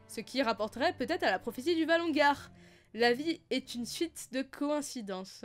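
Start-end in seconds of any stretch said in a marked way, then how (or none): 2.9-5.4 dramatic aside. Acted.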